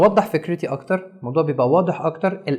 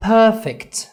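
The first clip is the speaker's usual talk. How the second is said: "perfect" higher, PER hit; stress on first syllable; 5 semitones higher, 4 dB louder than usual. Shown as a demonstration. Teacher isolating the word, PER stressed. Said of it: "عملت stress on the first syllable".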